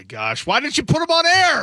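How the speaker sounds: high, incredulous demand; exasperated outburst. Real, not acted. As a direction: cry out as if it's a huge missed chance. Host mock-scolds producer over caller.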